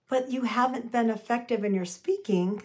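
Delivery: normal talking voice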